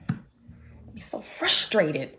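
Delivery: slow whisper; frustrated whisper; whispered aside. Real, not acted. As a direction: breathe it out under the voice. Aside after YouTube rant.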